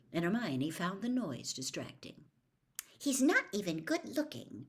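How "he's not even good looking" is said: higher irritated grumble; angry mutter. Acted. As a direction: grumble it under your breath. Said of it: "she muttered angrily".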